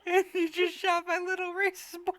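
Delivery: high whiny complaint; fake-wounded whine; mock indignant. Real, not acted.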